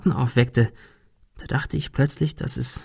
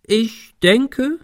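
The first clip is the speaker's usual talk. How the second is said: high, mock-pompous opening; exaggerated; 11 semitones higher than usual. A narrator's reading. Ben teasing before saying yes, exaggerated. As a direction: draw it out with hammed-up deliberation. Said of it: "fuhr er übertrieben fort".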